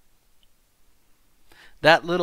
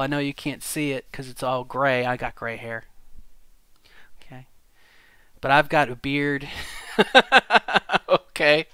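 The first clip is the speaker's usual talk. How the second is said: usual speaking voice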